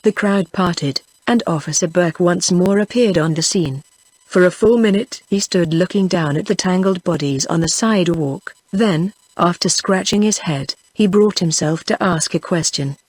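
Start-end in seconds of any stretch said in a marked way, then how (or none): none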